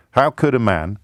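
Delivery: ordinary talk, nothing marked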